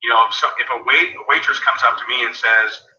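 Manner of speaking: ordinary talk, nothing marked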